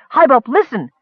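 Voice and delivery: high, loud urgent plea; insistent. A narrator's reading. Miner trying to get his leader's attention, insistent. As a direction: press him to pay attention. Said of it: "the red dripping miner insisted".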